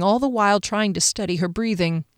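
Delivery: plain reading voice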